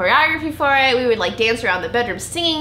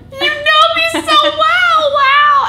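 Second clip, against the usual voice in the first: much higher, louder, slow mock gush; gushing mom; 8 dB louder, much slower than usual. Mom thanking the other mom for the early time, hammed-up gush. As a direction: sing it out, exaggerated delight. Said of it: "And my mom's like".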